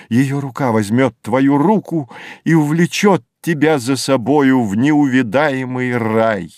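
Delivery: ordinary delivery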